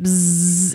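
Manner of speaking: buzzing like fluorescent lights; imitated electric buzz. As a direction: hum a droning bzzz.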